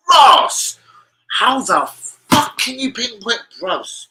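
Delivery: high, loud, drawn-out disbelief; astonished outburst. Retold or imitated. Storyteller reacting to being recognised, loud astonishment. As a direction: act out being staggered, pitch shooting up.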